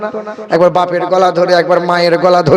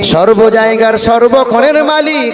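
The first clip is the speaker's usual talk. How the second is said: rising sermon chant; full-force preaching; 3 semitones higher than usual. Acted. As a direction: preach it out to the crowd, swelling.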